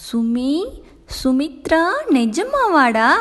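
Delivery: can't believe the good news; overjoyed disbelief. Acted. Husband discovering his wife's pregnancy, overjoyed and disbelieving.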